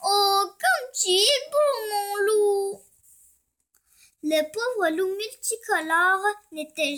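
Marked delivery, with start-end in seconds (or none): 0.0-2.8 high, cooing put-on voice; admiring she-wolves. A narrator's reading. She-wolves fawn at the wolf's ear, cooing. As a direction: coo it high, like a smitten she-wolf. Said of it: "en murmurant à ses oreilles".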